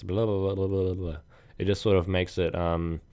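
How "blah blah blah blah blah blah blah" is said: quick run of blahs, mock sped-up audio; sped-up chipmunk babble. Shown as a demonstration. Podcaster showing what speeding up audio would sound like, rapid gibberish. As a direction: babble it like a recording played too fast. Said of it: "like chipmunk sounds".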